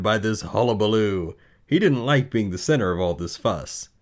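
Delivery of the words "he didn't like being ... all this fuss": ordinary delivery, nothing marked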